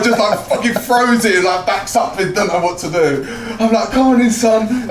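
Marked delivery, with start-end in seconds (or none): 3.9-4.9 high, loud, slowed challenge; drawn-out, pitched-up mock beckoning; retold taunt. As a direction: act out goading someone into a fight. Acted calling opponent into fight.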